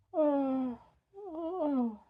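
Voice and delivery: yawning while talking; words stretched through a yawn. Real, not acted. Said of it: "sorry, I'm yawning".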